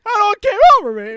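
high, loud fake-crying wail; hammed-up tearful blubbering; mock crying. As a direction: tease with an exaggerated crying voice. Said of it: "You start crying".

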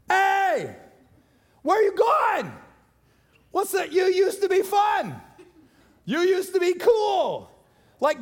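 put-on high, loud jeering shout from the old crowd; mocking crowd voice. Old crowd jeering at a believer leaving, high loud mocking shout. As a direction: call after them, high and loud, like friends who won't let go. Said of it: "guess what this crowd does?".

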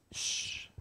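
soft unvoiced shush; Jesus shushing the wind; hushing shh. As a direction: hush the storm like a child.

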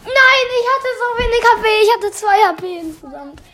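loud fast high protest; excited. Real, not acted. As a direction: blurt it out, worked up. Excited near-death protest.

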